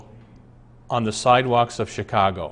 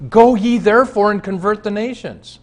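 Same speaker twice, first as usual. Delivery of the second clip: preaching; 9 semitones higher, 5 dB louder than usual. Acted. Preacher quoting Jesus dramatically.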